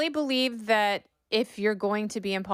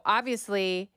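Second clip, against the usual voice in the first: ordinary delivery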